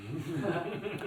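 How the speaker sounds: soft breathy chuckle; stifled little laugh. Real, not acted. Reader laughs after line.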